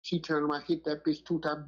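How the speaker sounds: ordinary delivery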